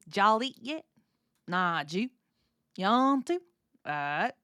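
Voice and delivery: slurred-together words in a Southern drawl; Southern US dialect. Shown as a demonstration. Two Southerners swap run-together words in a comedy bit, Southern accent. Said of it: "bit about how people speak in the South".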